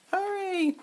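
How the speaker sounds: very high, drawn-out call; mock urgent call. Climbing toy figure urging the others on, mock urgent call. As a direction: pipe it up high, without real volume. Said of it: "he'll say".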